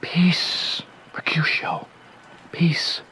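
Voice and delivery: urgent whispered hush; breathy whispered plea to stop. Acted. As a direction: quietly hush a raving friend. Romeo hushing Mercutio's rant.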